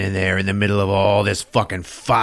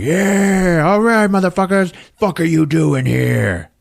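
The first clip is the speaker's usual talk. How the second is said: higher and louder, a rowdy taunting challenge; rowdy tribe warriors; 9 semitones higher, 4 dB louder, slower than usual. Retold or imitated. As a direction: jeer at the intruders, pitched up. Mountain Tribe confronting the intruders.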